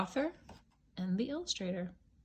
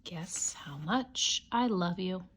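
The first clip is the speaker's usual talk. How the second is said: whisper. Shown as a demonstration. Whispering the title to a child at bedtime. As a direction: breathe the title like a secret. Title read two ways.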